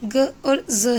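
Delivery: "ग" and "ज" as bare consonant sounds, g and z; isolated letter sounds. Shown as a demonstration. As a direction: sound out "ग" and "ज" separately. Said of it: "एक्स की दो sound होती है".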